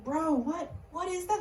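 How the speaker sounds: high, soft incredulous question; baffled. Acted. David first hearing the giant's taunts, high hushed bafflement. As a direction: ask it quietly, acting stunned.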